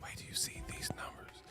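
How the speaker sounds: mock whisper in the ear; breathy whisper, close and secretive; right in your ear. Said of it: "he whispered to Dezo".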